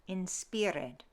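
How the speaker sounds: "inspired" as in-SPEER-ed, slowly; Middle English pronunciation. Shown as a demonstration. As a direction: say "inspired" with an EE and sound the -ed. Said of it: "The I sound shifts to an".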